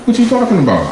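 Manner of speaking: high, mock-innocent what; innocent surprise. To his girlfriend at dinner, mock-innocent surprise. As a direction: play dumb when caught cheating. Caught cheating, playing innocent.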